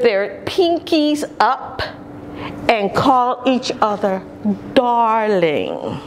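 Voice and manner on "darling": ordinary delivery, nothing marked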